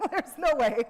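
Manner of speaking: loud, high laughing disbelief. Real, not acted. Speaker joking she couldn't twine her own salmon net, laughing loudly. Laughs at idea of twining nets.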